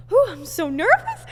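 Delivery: high, loud nervous outburst; re-enacted jittery exclamation; imitated nerves. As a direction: blow out a whew, then squeal the nerves. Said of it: "I was like".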